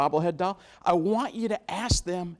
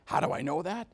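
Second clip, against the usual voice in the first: ordinary talk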